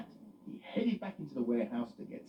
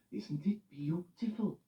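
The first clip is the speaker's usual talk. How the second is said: Loretta delighted; 6 semitones higher, slower than usual. Acted. Loretta voiced.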